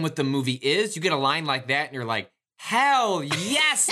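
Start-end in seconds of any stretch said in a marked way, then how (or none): 2.6-3.9 excited cheer. Retold or imitated. Imagined cheering audience reaction.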